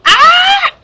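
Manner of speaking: very loud, high startled cry; startled shout. Real, not acted. Medium feeling energies combine, very loud high startled shout. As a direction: shriek out as if hit by a jolt.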